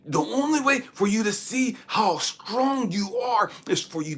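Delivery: high, loud, driving exhortation; full-force preaching. Real, not acted. Preacher explaining testing by the bench press, forceful. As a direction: punch out each phrase at full voice.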